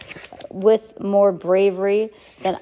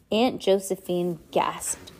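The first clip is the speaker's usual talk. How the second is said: plain reading voice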